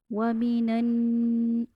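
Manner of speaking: वमीनन very slow, the नन nasalised and held; ghunna recitation. Shown as a demonstration. Teacher shows nasal ghunna on वमीनन, very slow recitation. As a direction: hum the नन of वमीनन through the nose. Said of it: "इसको कहते हैं गुन्ना".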